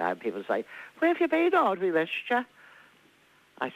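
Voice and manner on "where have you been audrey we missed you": imitating friends calling out much higher and brighter; mock cheery greeting. Neighbours welcoming Audrey back, mock cheery high greeting. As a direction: pitch it up like neighbours glad to see her. People greeting her warmly.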